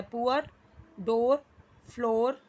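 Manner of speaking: "पुअर" with oo-er, then "डोर" and "फ्लोर" with long o; word by word, set "पुअर" apart from rhyming "डोर", "फ्लोर"; contrasting pronunciations. Shown as a demonstration. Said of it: "यहाँ पे pronunciation अलग हो जाता है".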